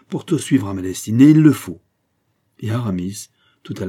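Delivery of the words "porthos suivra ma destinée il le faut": resolute declaration. A narrator's reading. Aramis resolves to take Porthos.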